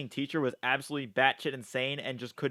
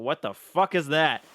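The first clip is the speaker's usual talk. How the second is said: ordinary talk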